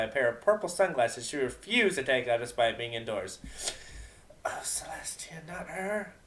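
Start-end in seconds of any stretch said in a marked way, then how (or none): 4.4-6.1 soft, breathy dismayed groan; groaned under the breath. A narrator's reading. Octavia dreading Vinyl's arrival, groaning under her breath. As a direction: mutter it to yourself in dread. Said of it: "Octavia groaned internally".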